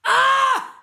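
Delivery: sudden high, loud scream of shock; shocked shout. Real, not acted. Vlogger learning True ghosted Shantae, sudden shocked scream. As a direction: yelp in disbelief.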